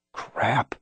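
soft hissed curse under breath; whispered. A narrator's reading. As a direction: whisper it so no one hears. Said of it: "I whispered as they vanished".

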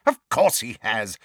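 curt, mildly impatient reply. A narrator's reading. Neighbour answering Harry's question, mildly impatient. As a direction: answer as if it is obvious. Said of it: "said Missus Figg impatiently".

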